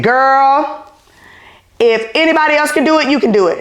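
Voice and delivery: a high, lightly voiced mom reassuring her daughter; Mama building her daughter up, quick and high; mother's voice. Mother reassures her scared pregnant daughter, lightly voiced high mom. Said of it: "I gotta say it in my mother's voice".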